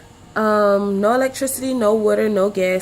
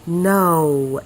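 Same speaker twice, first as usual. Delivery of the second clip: ordinary delivery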